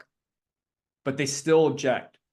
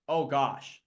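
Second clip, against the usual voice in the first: ordinary talk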